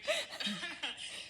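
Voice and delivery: high nervous laugh after workout; short jittery laugh. Real, not acted. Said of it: "Sorry, I'm all jittery".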